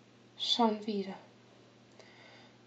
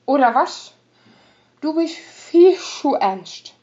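loud, taunting rogue voice; mocking drunk rogue; 7 dB louder than usual. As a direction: tease her with lazy scorn. Ota mocks Chico, drinking.

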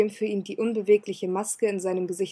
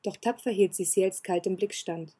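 plain reading voice

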